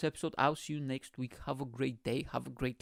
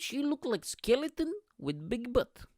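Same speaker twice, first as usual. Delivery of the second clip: high-pitched comic quote; imitated character voice; 10 semitones higher than usual. Retelling a remark about the skeleton costume, high imitated voice.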